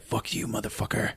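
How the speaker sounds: hushed, voiceless curse; defiant whisper. Acted. Captive woman to the guard with the flashlight, defiant whisper. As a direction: curse him under your breath.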